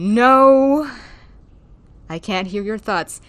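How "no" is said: a flat, loud no; blunt loud no. Acted. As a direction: shut it down hard. Blunt in-character reply.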